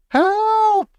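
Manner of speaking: very high, held cry for help; terrified scream. A narrator's reading. Mother terrified of the mouse, screaming for help. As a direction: hysterical squeal of alarm. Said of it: "screamed Missus Jenkins".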